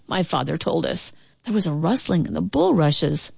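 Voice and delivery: plain reading voice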